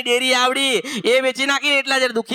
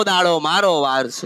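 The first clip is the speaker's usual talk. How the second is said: low, slow proclamation as the goddess; 7 semitones lower than usual. Acted. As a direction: drop the voice and declare it is her day. Medium speaks as goddess Meldi. (દેવીનો નીચો, ધીમો અવાજ)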